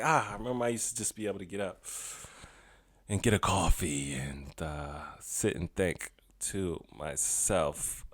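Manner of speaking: soft, low, slowing to a near murmur; hushed, low musing to oneself; wistful reminiscing. Acted. Imagined wistful look back at lost moments.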